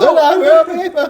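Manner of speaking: high, loud panicked comedian impression; exaggerated high-pitched protest; Jerry Seinfeld. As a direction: yelp it as a hammed-up sitcom comic. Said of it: "is him, like, panicked".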